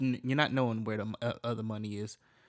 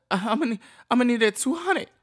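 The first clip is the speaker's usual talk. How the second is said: insistent; 10 semitones higher, 4 dB louder than usual. Real, not acted. Insisting on money.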